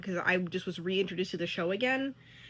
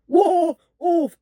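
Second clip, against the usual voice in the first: loud, higher, short mock calls; pretend calling out; 6 semitones higher, 11 dB louder than usual. Imagining children named Wolf, mock calling out. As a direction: call the silly name out, mockingly.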